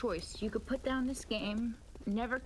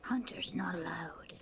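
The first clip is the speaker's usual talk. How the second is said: whisper; 3 semitones lower than usual. Retold or imitated. Echoing the game NPC's line.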